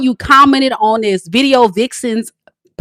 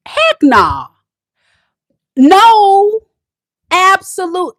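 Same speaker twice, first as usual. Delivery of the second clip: higher, louder flat refusal; 11 semitones higher, 6 dB louder, much slower than usual. Retold or imitated. Woman refusing her boyfriend, loud. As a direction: shut it down loudly. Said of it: "let them know".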